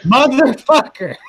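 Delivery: high, loud outburst of frustration; exasperated curse. Real, not acted. Game master cursing as the gear stops working, exasperated. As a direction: curse loudly at the misbehaving gear.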